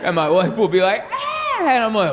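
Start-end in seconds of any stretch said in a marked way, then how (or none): 1.1-1.6 very high, soft gasp; mock startled gasp. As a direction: gasp like a spooked wife. Wife's startled noise.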